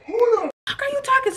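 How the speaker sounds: very high-pitched mock outrage; imitated teeing up. Other girl going off on the narrator, high mock outrage. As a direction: mimic her going off in a squeal. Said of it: "she starts teeing up on me".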